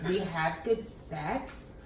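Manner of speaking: slow, drawn-out mock Chantel; mock her slow speech; slow-motion talk. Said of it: "Chantel speaking slow motion".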